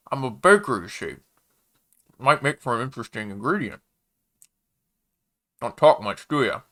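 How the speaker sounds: talking through a mouthful; chewing while speaking; mouth full. A narrator's reading. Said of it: "mouth full".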